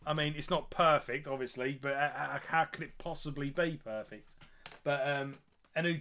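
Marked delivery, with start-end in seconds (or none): none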